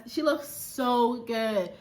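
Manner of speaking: ordinary talk, nothing marked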